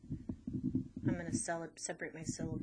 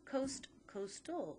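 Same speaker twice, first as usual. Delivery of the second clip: slow and higher, coast then coastal; root and suffix; 6 semitones higher, slower than usual. Shown as a demonstration. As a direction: contrast coast with coastal slowly. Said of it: "That's my root word".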